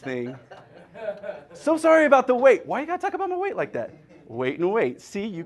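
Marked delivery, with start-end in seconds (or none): 1.5-2.7 high, loud replay with a laugh; mock apology. Comic replaying the young man's apology, high and amused. 2.7-3.9 high, fast indignant squeal; mock offence. Comic replaying a complaint about weight, high fast mock offence.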